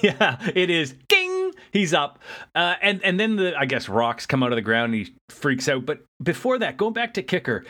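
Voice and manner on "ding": very high-pitched "ding" like a bell; imitated ding. Joking that he pops straight up, high imitated ding. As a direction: sing out a high comic ding. Imitates instantly popping upright.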